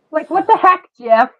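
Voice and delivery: "what the heck" exclaimed high and loud; calling out, exclaimed. Real, not acted. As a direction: call the line out to Jeff. Repeated louder, higher to Jeff.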